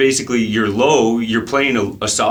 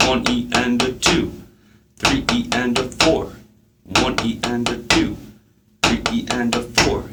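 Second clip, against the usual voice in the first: steady rhythmic sixteenth-note count. Shown as a demonstration. Drum teacher counting sixteenths for students, evenly in time.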